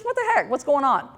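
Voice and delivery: can you believe this, up high; exasperated disbelief. Real, not acted. Exasperated at cartoon censorship.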